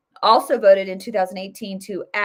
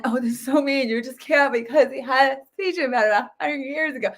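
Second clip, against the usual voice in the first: higher whiny mock objection; a hint of a prissy critic; 4 semitones higher than usual. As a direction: whine it like a scolding critic.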